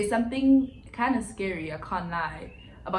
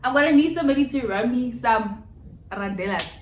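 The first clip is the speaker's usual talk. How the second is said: mock dramatic demand; 5 semitones higher, 9 dB louder than usual. Real, not acted.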